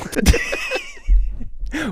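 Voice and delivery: high-pitched burst of laughing; real laughter. Real, not acted. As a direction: crack up at your own joke. Amused at his own nickname joke.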